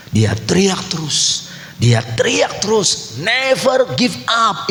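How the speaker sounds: slow rallying build; building fervour. Real, not acted. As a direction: drag the words out, rising to a rallying cry. Preacher builds on repeated shouting.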